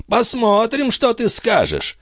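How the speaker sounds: high-pitched, jeering urging; mocking invitation. A narrator's reading. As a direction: egg him on mockingly, pitched well up. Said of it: "насмешливо пригласил он Био".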